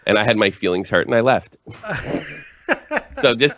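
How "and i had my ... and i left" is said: normal talking voice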